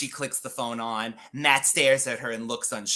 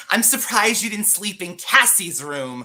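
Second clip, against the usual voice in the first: condescending; 6 semitones higher, 4 dB louder than usual. A narrator's reading. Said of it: "Laura says condescendingly".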